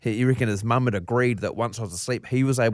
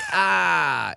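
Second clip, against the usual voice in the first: high, drawn-out mock "aah"; play-along pain cry; 6 semitones higher than usual. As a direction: fake the hurt to play along. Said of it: "And I'll be like".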